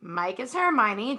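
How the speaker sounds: ordinary delivery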